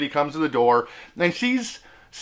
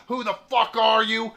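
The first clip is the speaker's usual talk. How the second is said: higher-pitched mock throat-device challenge; cussing electrolarynx imitation; 5 semitones higher than usual. Old man challenging stranger at door, mock electrolarynx challenge. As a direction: imitate a hostile old man through a voice buzzer. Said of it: "He is cussing me out left and right".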